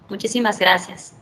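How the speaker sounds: ordinary talk, nothing marked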